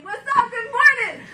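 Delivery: high, bright-eyed morning hello; perky high-pitched greeting; cheery office greeting. Acted.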